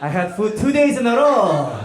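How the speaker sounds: overjoyed about having food; mock ecstatic North Korean comic; hammed-up comedian.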